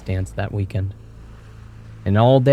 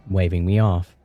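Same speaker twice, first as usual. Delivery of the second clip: usual speaking voice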